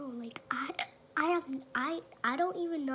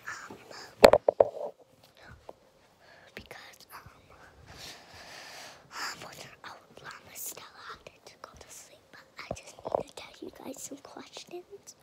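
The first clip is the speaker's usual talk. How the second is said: breathy secret-telling whisper; 5 dB louder than usual. Real, not acted. Whispering to camera throughout.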